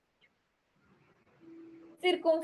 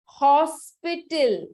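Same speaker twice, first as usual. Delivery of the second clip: Hospital stretched out, louder at the start; slow, emphatic presentation; 5 dB louder, slower than usual. Shown as a demonstration. Teacher showing class a famous word, slow and emphatic. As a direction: present Hospital slowly and firmly.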